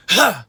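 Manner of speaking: short, loud, high "huh"; scream attack. Shown as a demonstration. Coach showing how to execute it, sharp screamed burst. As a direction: punch a burst of air from the diaphragm. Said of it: "that's how you execute it".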